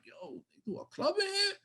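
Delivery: soft, high-pitched retold disbelief; hushed squeak of surprise; incredulous reaction. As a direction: ask it small and high, as if baffled. Retold disbelief entering club.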